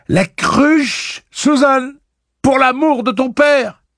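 high, full-force pleading yell; frantic shouted plea; urgent shouting. A narrator's reading. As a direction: yell desperately from a locked cell. Roland keeps yelling from his cell.

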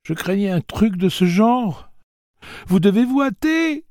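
a small high voice pleading urgency; little Blaine. Acted. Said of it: "celle du petit Blaine".

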